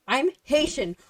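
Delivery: outraged retort. Retold or imitated. Answering her boyfriend's government cheese question, outraged. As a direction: shut the question down in disbelief. Her retold indignant reply.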